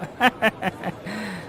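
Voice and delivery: oily chuckle. Acted. Fawning shopkeeper laughs.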